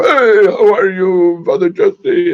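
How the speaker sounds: big, high, drawn-out hollered greeting; really loud. Retold or imitated. Loud priest greeting Father Jesse, hollered high and long. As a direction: imitate a booming, boisterous hello, stretched out. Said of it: "He was really loud".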